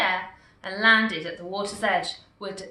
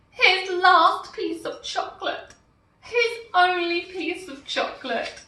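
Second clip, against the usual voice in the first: dismay; 9 semitones higher, 5 dB louder than usual. A narrator's reading. Dismay at lost chocolate.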